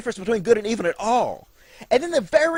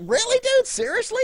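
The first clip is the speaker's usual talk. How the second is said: squeaky high disbelief, much higher; incredulous; 13 semitones higher than usual. Retold or imitated. Adam to God, squealing high in disbelief. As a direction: shoot the voice way up in shock. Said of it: "And I was like".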